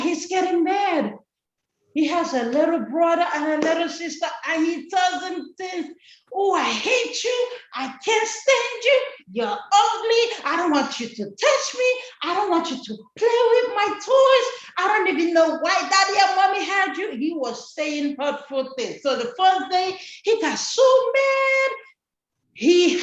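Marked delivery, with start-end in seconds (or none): 6.3-17.1 high-pitched, fast, hammed-up angry child; childish tantrum. Angry boy yelling at his little brother and sister, fast and high. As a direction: rattle off the insults like a furious little kid.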